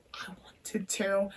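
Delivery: breathy whispered admission, slowed right down; a guilty, slow whisper. Real, not acted. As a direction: let it drop to a hushed confession. Confessing wish to slap mum.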